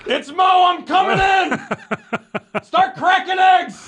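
high, slow hammed-up shout; mock diner call. Teasing Mo, mimicking her phoning the diner in a big slow shout. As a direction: bellow it like barging into the diner.

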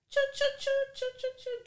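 high-pitched choo-choo driving sounds; imitated car noise.

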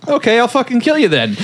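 high, fast exasperated outburst; mock angry threat. [name] to the goading gate man, high mock-angry threat.

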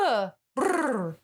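startled uh then rolled brrr; wing flutter. Shown as a demonstration. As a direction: imitate a bird bursting out. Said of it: "it was more of a".

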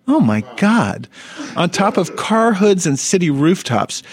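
usual speaking voice

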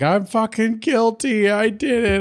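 high, slow put-on voice; Rarity voice. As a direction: play the guilty diva. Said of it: "She's like".

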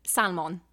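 "salmon" with the L sounded, SAL-mon; Polish-household pronunciation. Retold or imitated. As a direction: say "salmon" as SAL-MON, full O in the second syllable. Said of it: "every single Polish person I know".

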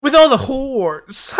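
slow, loud mock announcer read; imitating the ad voice. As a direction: stretch it out like the ad man.

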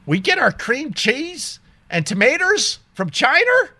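high, disbelieving query; incredulous question. Retold or imitated. Customer questioning the bagel cashier about China, incredulous. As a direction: act out baffled disbelief, pitched high.

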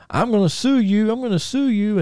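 high-pitched mock outrage; irate fan. Fan threatening Buck after being hit by flying wood, high mock protest. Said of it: "that mark was like".

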